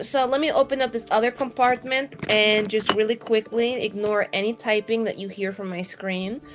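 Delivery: ordinary delivery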